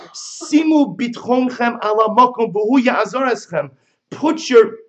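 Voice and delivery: rally the brothers with fire; urging bride. Acted.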